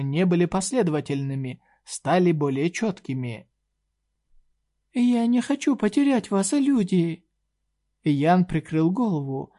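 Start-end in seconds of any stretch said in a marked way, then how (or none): 4.9-7.2 high, pleading fairy voice; a small fairy begging, much higher; pixie. A narrator's reading. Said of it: "голос розовой феи".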